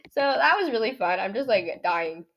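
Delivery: ordinary talk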